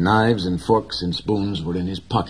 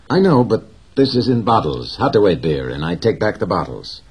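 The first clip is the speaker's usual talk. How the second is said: put-on louder young boy explaining; an earnest boy, voiced higher and louder; boy's voice; 4 semitones higher, 8 dB louder than usual. A narrator's reading. Boy's voice in read story.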